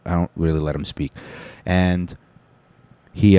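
normal talking voice

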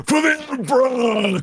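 high slow shouted war cry; battle cry. Shown as a demonstration. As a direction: roar it for the Emperor. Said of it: "I broke the microphone from shouting".

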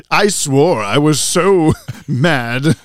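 loud, hammed-up accent, sort of Alfred; mock Alfred-like accent. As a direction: overact in a wobbly butler-like accent. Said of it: "What was that accent?".

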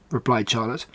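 plain reading voice

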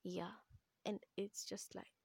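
plain reading voice

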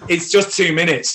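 loud, high, impassioned reassurance; emphatic pep talk. Real, not acted. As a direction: drive it home like a rousing coach. Hammering home the same reassurance.

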